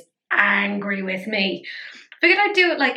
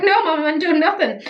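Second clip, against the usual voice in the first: higher, louder innocent cat denial; voicing the cat; 7 semitones higher, 5 dB louder than usual. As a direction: play the cat protesting innocence. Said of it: "She's like".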